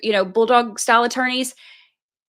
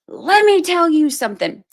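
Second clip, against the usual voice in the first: aggressive lawyer; 6 semitones higher, 4 dB louder than usual. Retold or imitated. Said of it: "was just immediately like".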